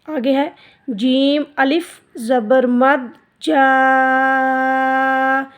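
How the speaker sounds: जा held very long, four beats; long madd. Shown as a demonstration. Teacher showing the big madd to pupils, long. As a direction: stretch जा long. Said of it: "इसको बड़ी मद बोलते हैं".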